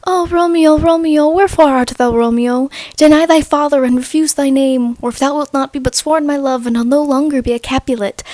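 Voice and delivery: theatrical monologue, starting high and loud; declaimed Shakespeare, easing down gradually; Juliet. Acted. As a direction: recite it as a yearning stage soliloquy. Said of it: "one of the most famous monologues is from Romeo and Juliet".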